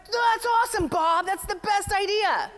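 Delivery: high, loud gushing colleagues; over-the-top cheering for a coworker's idea; mock enthusiasm. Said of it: "They're like".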